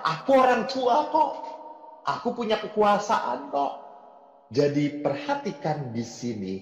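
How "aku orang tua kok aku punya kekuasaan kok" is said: proud parent voice. Retold or imitated. Preacher voicing arrogant parents, mock haughty. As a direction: sound smug about parental power.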